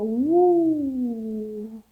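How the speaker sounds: drawn-out, gliding "woo"; owls' flying cry; long owl hoot. Acted. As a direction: let the hoot swoop and fade away. Owls flying off, sound acted.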